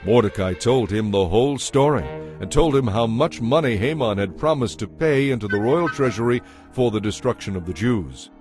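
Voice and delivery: ordinary talk, nothing marked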